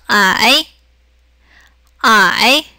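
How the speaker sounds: 아이 dipping low, then rising; drawn-out dip and lift on 아이; third tone. Shown as a demonstration. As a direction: say 아이 in Mandarin third tone. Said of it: "해서 삼성".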